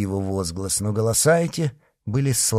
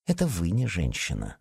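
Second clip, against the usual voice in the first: low, soft mutter; subdued, lowered grumble; 4 semitones lower, 7 dB quieter than usual. A narrator's reading. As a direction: mutter the retort under your breath. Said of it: "пробормотал Мазарини".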